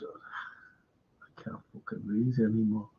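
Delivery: low breathy whispered aside under the breath; a muttered whisper of frustration. Real, not acted. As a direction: drop to a whisper, half to yourself. Muttered aside while reading.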